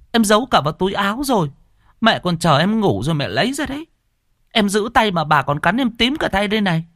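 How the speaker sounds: high, indignant self-defense; aggrieved protest. Acted. Sister defends herself to scolding brother, aggrieved. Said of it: "Cô Lan ấm ức".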